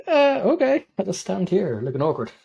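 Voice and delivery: mock the awkward friend left standing there; awkward Tomas. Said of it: "Whereas Tomas is like".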